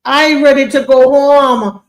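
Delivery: put-on slow, loud Caribbean accent. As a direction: drag it out in a Caribbean lilt. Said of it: "if she even wrote it in a Caribbean accent".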